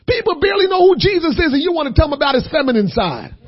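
loud, high, driving sermon delivery; full-force preaching. Acted. Preacher urging the congregation, loud and fervent. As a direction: preach it out with fire.

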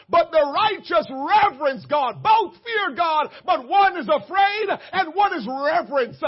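loud, high, driving pulpit shout; full-force preaching. Real, not acted. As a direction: preach it at the top of your voice.

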